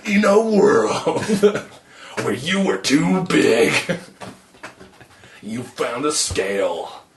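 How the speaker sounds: drawn-out epic trailer read; movie announcer voice. Retold or imitated. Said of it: "like the movie announcer guy".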